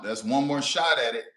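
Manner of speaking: usual speaking voice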